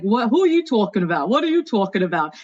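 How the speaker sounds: light imitation of a Brooklyn New York accent. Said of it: "most of my family sound like Rocky Balboa".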